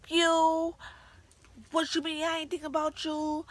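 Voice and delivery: high, loud mock tirade; imitated screaming rant. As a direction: yell it like a furious boyfriend going off. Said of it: "he's legit just screaming".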